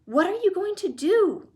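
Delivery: high, loud anxious cry; alarmed cry. Acted. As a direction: call out in worry. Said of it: "cried Charlie".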